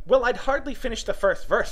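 high, animated Hatter voice; chatty, know-it-all Hatter. Acted. Hatter explaining to Alice how time obliges, eager high voice. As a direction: explain eagerly, pitched well up.

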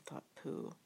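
usual speaking voice

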